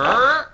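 voiced sound effect; imitated noise. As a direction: mimic a noise sliding upward in pitch. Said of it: "And then you hear".

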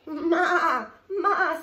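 loud, high wavering goat call; goat bleat. A narrator's reading. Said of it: "said the goat".

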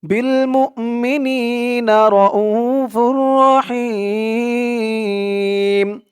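slow, melodic Quran chant; tajweed recitation, every vowel drawn out; recitation to repeat. Shown as a demonstration. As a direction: recite so the class can chant along. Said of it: "আমার সাথে বলুন".

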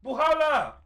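high, loud garbled yells; panicked shouting. Real, not acted. Gamer warning his friend of the thing, loud panicked shouting. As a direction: blurt it out in alarm.

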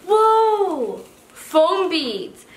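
high, drawn-out exclamation of wonder; amazed. Real, not acted. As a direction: let out a high, amazed cry. Amazed at next item in box.

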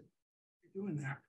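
a quiet, far-off muttered question; murmured confusion at the chatbot; puzzled aside. Retold or imitated. User puzzled by the bot's narration, murmured quietly.

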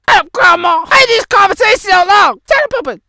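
cartoonish yell of shut up; mock yelling. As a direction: bark it at them.

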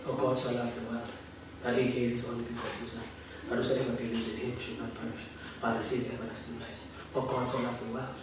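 fast, soft murmur, very low; rapid whispered recitation under the breath; murmured scripture. Shown as a demonstration. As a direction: murmur the verse to yourself, quick and hushed. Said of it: "When you meditate".

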